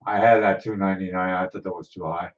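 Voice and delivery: normal talking voice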